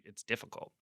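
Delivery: ordinary talk, nothing marked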